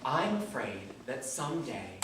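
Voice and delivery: ordinary talk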